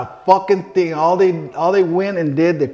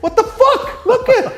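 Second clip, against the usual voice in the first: laughing shocked cry at wife; laughing disbelief; 6 dB louder than usual. Retold or imitated. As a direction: squeal it out through a laugh. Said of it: "so I go".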